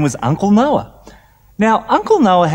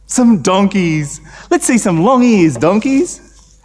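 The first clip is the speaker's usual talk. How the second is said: ordinary talk, nothing marked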